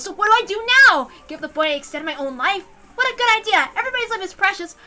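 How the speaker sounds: rising pitch, rambling in fear; panicked inner rant. A narrator's reading. Panicked inner monologue under a gun.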